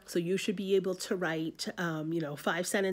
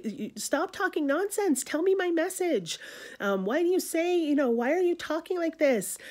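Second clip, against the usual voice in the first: much higher, snappish and exasperated; short-tempered Juliet; 11 semitones higher, 3 dB louder than usual. Retold or imitated. Juliet snaps at the distracted nurse, short-tempered. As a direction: scold the rambling nurse sharply. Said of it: "Juliet is very short with the nurse".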